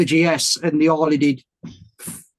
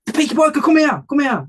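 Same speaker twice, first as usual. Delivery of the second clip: higher mock call across the stand; imitating a friendly shout across a crowd; 9 semitones higher than usual. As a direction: hail him over, pitched up high. Retold guy calling him over.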